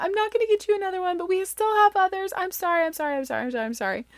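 high, fast, fading repeated sorries; flustered apology. Retold or imitated. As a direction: mom apologizing to her kids, high then fading. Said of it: "and I'm like".

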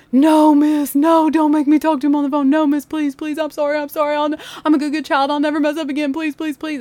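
loud, high mock pleading kid; panicked begging. Kyle begging teacher to avoid dad's call, mock panic. Student begs not to take call.

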